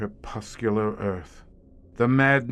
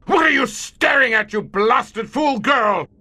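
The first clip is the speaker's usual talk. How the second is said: furious shout; 10 semitones higher, 5 dB louder than usual. Acted. Commander turning on the staring visitor, furious shout. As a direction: bark it at her in rage.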